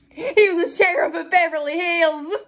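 high, loud punchline; excited reveal. Acted. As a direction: burst out with the big reveal. Excited reveal of who he was.

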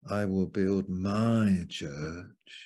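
"my" drawn out and lifted before "church"; slow down and lean hard on "my"; stress on MY. Shown as a demonstration. Preacher stressing whose church it is, stress on "my". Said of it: "I should emphasize".

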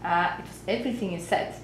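ordinary talk

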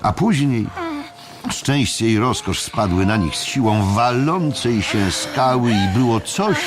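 ordinary talk

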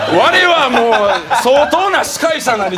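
slow, grand archaic declaration, half laughing; hammed-up lordly host pronouncing himself; mock pompous MC. Teller mocking the senior's self-important emcee, slow and grand. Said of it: "という感じで".

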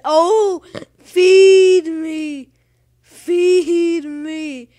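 very loud, drawn-out hammy cry; hungry mock wail. Gamer playing a hungry blob after eating players, mock wailing shout. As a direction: bellow it like a ravenous blob.

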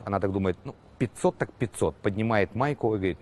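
quiet, low joke telling; hushed joke telling. A narrator's reading. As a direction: tell it under your breath. Whole joke told quietly.

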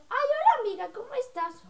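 very high, sing-out imitation; mock friendly greeting. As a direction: mimic a friend's gushy hello. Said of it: "después del recreo".

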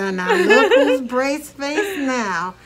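slow, laughing tease; laughing teasing. Real, not acted. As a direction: tease her through a laugh.

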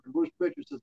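ordinary talk, nothing marked

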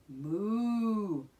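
long drawn-out moos; mooing cow. A narrator's reading. Spotted cow moos while being milked, long moos. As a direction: moo like a cow, swelling and falling. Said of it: "said the spotted cow".

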